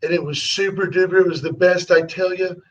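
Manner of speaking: Trump-style boastful asides, rambling and repeating; sales-pitch Trump impression with emphatic repeats; Trump impersonation. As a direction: do Trump tossing out self-satisfied asides. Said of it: "work Trump's little impersonation, you gotta learn to".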